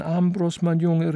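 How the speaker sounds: high, loud, excited greeting; effusive auntly cry; calling out. A narrator's reading. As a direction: call out to a dear boy as you rush in. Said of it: "rief sie".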